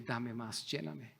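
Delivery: tender whispered-close prayer, fading away; hushed prayer. Shown as a demonstration. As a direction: murmur it as an intimate plea. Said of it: "Y dile".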